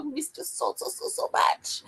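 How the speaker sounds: breathy, laughing, trailing off; laughing fondness. Real, not acted. Host laughing fondly to a returning friend. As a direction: laugh through the words.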